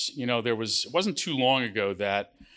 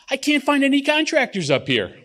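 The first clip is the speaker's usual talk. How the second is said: high, louder, fed-up punchline; God whining that there's no contractors in heaven; exasperated God; 13 semitones higher, 7 dB louder than usual. Acted. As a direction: throw up your hands and blurt it out high. God's punchline reply.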